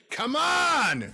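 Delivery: high, loud, pushing Emil; urging. Acted. Emil pushes the hero to finish, loud urging. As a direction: urge the player on, loud and high. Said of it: "urges Emil".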